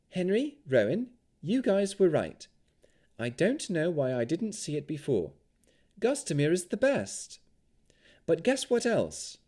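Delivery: put-on bright, high young woman's voice; a high, friendly young elf. A narrator's reading.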